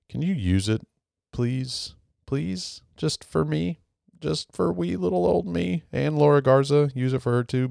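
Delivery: high, wheedling little plea; mock-pitiful pleading in a small high voice; playful pleading. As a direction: beg them sweetly, pitched well up. Begging listeners in a wee voice.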